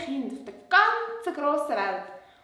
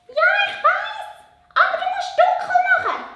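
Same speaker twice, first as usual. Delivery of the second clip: much higher little puppet voice; 9 dB louder than usual. Acted. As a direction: puppet girl, pitched way up. Puppet Lola answers.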